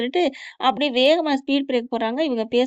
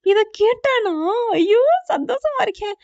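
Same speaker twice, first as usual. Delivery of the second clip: high, loud delighted outburst; thrilled disbelief; 10 semitones higher, 4 dB louder, slower than usual. Acted. Friend hears that he apologised, thrilled disbelief. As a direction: exclaim with joyful astonishment.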